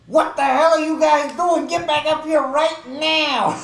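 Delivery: full-volume high yell, stretched out; shouting down stairs. Acted. Player shouting down the stairs to the party, loud yell. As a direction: yell furiously at friends a floor below. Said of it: "I'm gonna start shouting down the stairs".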